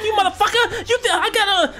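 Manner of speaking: high-pitched imitation of a ranting driver; hammed-up cursing out the window; squealed mock tirade through laughter; mock road-rage yell. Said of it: "hanging out of the window".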